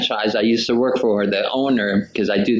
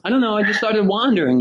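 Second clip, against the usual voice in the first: higher, lightly voiced little boy; child self voice; 9 semitones higher, faster than usual. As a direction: play your seven-year-old self, a bit higher. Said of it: "I'm like".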